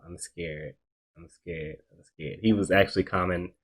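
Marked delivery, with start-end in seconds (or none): 0.1-2.4 very soft, low, repeated fearful murmur; scared muttering. Retold or imitated. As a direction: imitate yourself quietly repeating a fear, barely audible. Retelling how scared he was all night.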